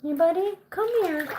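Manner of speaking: high, sing-song call to the bird; pet talk. Real, not acted. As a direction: coax the pet over in a high voice.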